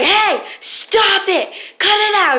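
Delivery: high, drawn-out calling out; mock-authoritative guard breaking up a fight; imitated security guard. Security guard to brawling students, imitated high call to stop. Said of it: "it was a security guard from our school".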